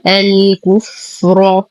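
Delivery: الكفر slowly, opened with a, ending in a heavy ra. Shown as a demonstration. Teacher demonstrates the word alone, slow heavy ر in الكفر. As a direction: thicken the final ر of الكفر. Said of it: "نفخم الراء لأنها مفتوحة".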